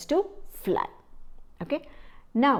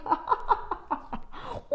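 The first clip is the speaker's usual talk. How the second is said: crow's laughter; 9 semitones higher, 6 dB quieter than usual. Acted. Crow laughing at the birds.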